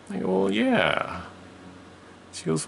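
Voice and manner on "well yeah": put-on child voice. Child self in story.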